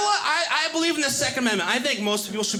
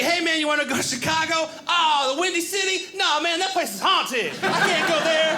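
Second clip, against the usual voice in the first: mock scared guide; 7 semitones higher than usual. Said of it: "he'd be like".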